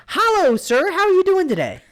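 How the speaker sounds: very high, loud, stiff customer-service voice; mock robotic politeness. Mocking cashiers' scripted politeness, very high and loud. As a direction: overdo the scripted service greeting. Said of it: "nobody talks like that".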